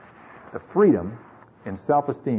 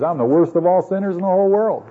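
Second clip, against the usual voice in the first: louder, higher quoted confession; emphatic declaration; 7 semitones higher, 5 dB louder than usual. Retold or imitated. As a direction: proclaim it, pitch held high to the end. Preacher quoting Paul's confession emphatically.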